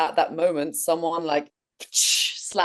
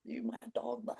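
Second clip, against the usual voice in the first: choked speechless plea; 8 semitones higher, 15 dB quieter than usual. Retold or imitated. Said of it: "no words can even come out".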